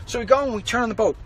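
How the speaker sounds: ordinary talk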